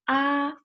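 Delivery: "ah" held high and level; first tone. Shown as a demonstration. Teacher gives an example, "ah" in high first tone. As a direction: sustain a high, flat "ah". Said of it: "first one".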